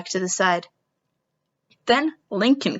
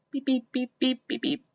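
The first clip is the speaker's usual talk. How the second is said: quiet robotic beep sounds; 2 semitones higher, 9 dB quieter than usual. Retold or imitated. Imitates robot beeping.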